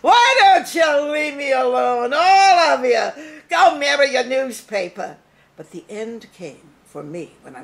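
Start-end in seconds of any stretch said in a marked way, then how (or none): 0.0-5.2 Barbara Stanwyck. Retold or imitated. Stanwyck heroine shouting at everyone to go away. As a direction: fling the lines like a furious heroine. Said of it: "Barbara Stanwyck was one of my favorites".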